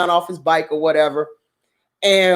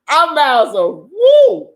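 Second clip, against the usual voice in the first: ordinary talk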